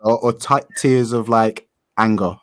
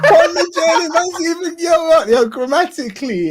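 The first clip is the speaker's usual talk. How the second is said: laughing; 4 dB louder than usual. Real, not acted. Still laughing at the name.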